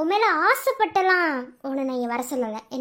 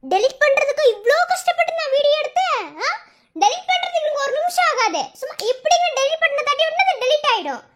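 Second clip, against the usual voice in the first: high-pitched, indignant scoffing; heated, jeering tirade; scornful taunting; 10 semitones higher than usual. Acted. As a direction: throw her plea back mockingly. Blackmailer scoffs at her plea.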